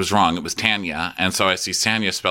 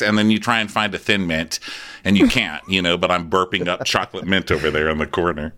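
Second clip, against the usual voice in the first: normal talking voice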